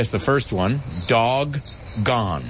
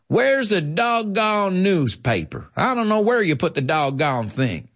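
higher, hammed-up Southern drawl; mock Southern; 8 semitones higher than usual. A teacher mimics a Southerner demanding the remote, hammed-up. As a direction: play a wild west Southerner. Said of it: "But in the South, say".

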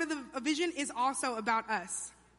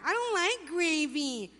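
put-on high, slow whining kid; whiny cousin; 4 semitones higher, slower than usual. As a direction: drag it out like a sulky child at dinner. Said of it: "a whiner".

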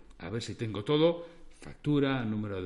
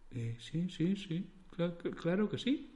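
stammering; 4 semitones higher, 8 dB quieter, slower than usual. A narrator's reading. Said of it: "Acertó a balbucear Lucas".

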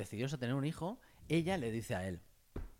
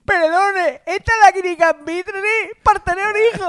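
high, loud put-on patient voice; mock clinic patient; 15 dB louder than usual. Joking with his cohost, a hammed-up patient asking at the clinic. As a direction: ham up a silly patient at the counter. Role-play at the IVF clinic.

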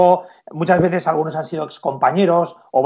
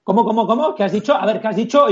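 pitched high, sharp challenge: what did you say; demanding referee; 7 semitones higher than usual. Retold or imitated. Referee challenging a player who muttered something, high and sharp. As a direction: pounce on him like a ref who half heard an insult.

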